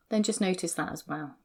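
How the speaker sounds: soft, unhurried guiding voice; guided meditation. Acted. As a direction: ease them gently into their body.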